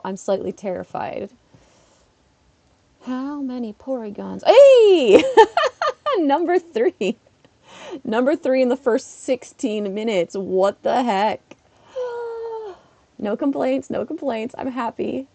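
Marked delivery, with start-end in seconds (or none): 4.5-10.3 high loud laughing shout; a delighted shriek at the rare spawn; laughing excitement. Real, not acted. Third Porygon appears. 10.3-11.6 slow disbelief. Real, not acted. Stunned at spawn count. 11.9-12.7 high startled gasp; a quick astonished intake of breath; sharp gasp. Real, not acted. Gasps at spawn.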